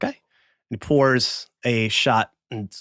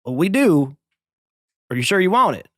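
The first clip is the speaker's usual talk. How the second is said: higher, hesitant saloon keeper; doubtful bartender; 6 semitones higher than usual. Acted. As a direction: warn him off, unsure. Bartender doubts the cheap whiskey.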